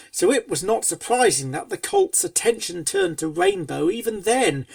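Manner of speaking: ordinary delivery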